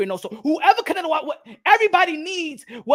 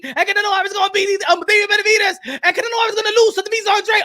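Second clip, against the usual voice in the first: rising steadily higher and louder; hammed-up fan getting more and more worked up; mock excited fanboy; 4 semitones higher, 4 dB louder than usual. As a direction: build from chatty to frantic high excitement. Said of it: "So they be like this".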